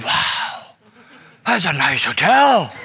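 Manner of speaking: unpitched, drawn-out wow, then slow amazement; awestruck child. Retold or imitated. Daughter seeing the hotel room, awestruck. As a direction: imitate a kid marvelling, stretching the words.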